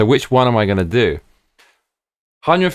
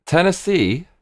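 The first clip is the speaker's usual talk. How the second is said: surprised question; 9 semitones higher, slower than usual. Real, not acted. Doubts going to Tennessee.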